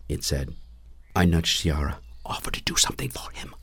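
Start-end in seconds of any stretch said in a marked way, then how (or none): 2.3-3.5 whispered urgent instruction; urgent hiss. A narrator's reading. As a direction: hiss it under your breath, out of the dragon's hearing. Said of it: "I hissed".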